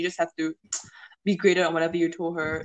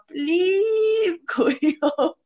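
laughing; 10 semitones higher, 3 dB louder, much slower than usual. Retold or imitated. Mocking senior going home.

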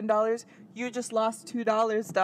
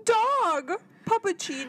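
high, gushing mock squeal; mock a barista thrilled by a dog; mock excitement; 8 semitones higher, slower than usual. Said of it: "I'm not gonna be like".

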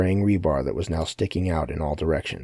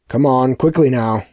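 loud, raised, impatient order; higher and louder, ordering them to move; commanding; 6 semitones higher, 7 dB louder than usual. A narrator's reading. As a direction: hurry them along with a forceful command. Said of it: "Sebastian commanded".

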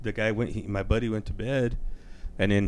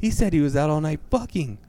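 imitated mother's higher-pitched indignation; flipped-out mother; 6 semitones higher than usual. Mom passing the son's words to dad, mock high indignation. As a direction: play the appalled wife, a little heightened. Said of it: "flipped the fuck out".

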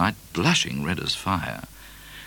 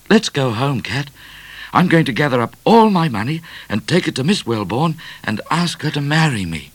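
high, eager plan; bubbling with his plan; eager young man; 9 semitones higher, 4 dB louder than usual. A narrator's reading. Midnight speaks excitedly.

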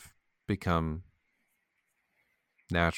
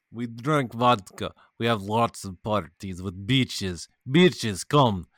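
put-on Russian party boast; Russian accent. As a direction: play a vodka-loving Russian. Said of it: "with a Russian accent".

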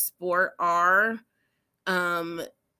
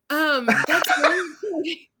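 laughing; 8 semitones higher, 5 dB louder, faster than usual. Real, not acted. Co-host laughing, near tears.

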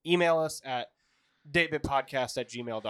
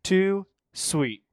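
louder wrestler catchphrase; wrestling catchphrase; 6 semitones higher, 7 dB louder than usual. Pretend. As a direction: call it out like a wrestler. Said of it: "your best either wrestler impression".

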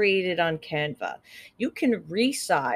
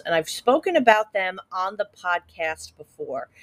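usual speaking voice

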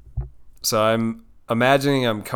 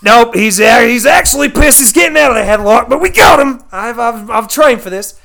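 loud, high Australian wildlife host; Steve Irwin; 8 semitones higher, 7 dB louder than usual. Acted. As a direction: narrate the big catch in excited broad Aussie. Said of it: "Steve Irwin's describing on his nature show".

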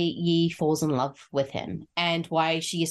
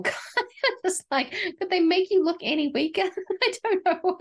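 high-pitched, through real laughter; helpless amusement breaking into the words; laughing; 13 semitones higher than usual. Real, not acted. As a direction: crack up while talking. Cracking up over poor actor.

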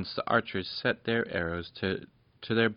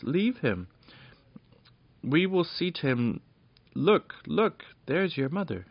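high, coaxing little fairy voices; eager fairy voices; 8 semitones higher, 3 dB louder than usual. Acted. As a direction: fairies pointing her to her mother. Fairies urge her away.

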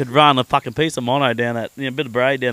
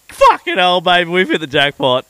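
high, loud burst of delight at the room; excited; 6 semitones higher, 7 dB louder than usual. Retold or imitated. Man to partner on seeing the suite, thrilled and loud. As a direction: crow about hitting the jackpot. Arriving at the luxury quarantine suite.

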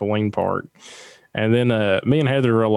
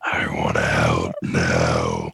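deep movie quote; much slower than usual. Retold or imitated. Quoting a movie line.

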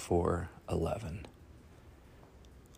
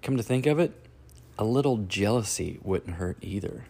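ordinary talk, nothing marked

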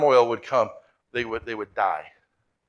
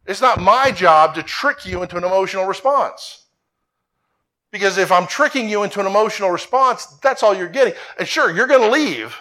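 preaching with force; 4 semitones higher, 4 dB louder than usual. Acted. Pastor preaching to congregation.

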